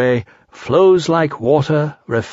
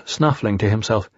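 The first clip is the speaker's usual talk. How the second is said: usual speaking voice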